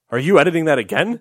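incredulous mock complaint; exasperated outburst. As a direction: fling the question out in mock despair.

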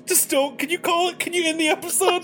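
high, whimpering panic; panicked pleading. Real, not acted. Player begs game master to stop, panicked pleading. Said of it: "might actually be having a small panic attack".

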